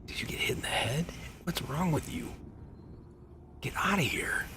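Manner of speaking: a sharp, high whisper that rises at the end; harsh whisper. Acted. Griffin whispers scornfully at the stranger, harsh whisper. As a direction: hiss it at him like he's lost his mind. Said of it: "He looks at you and says".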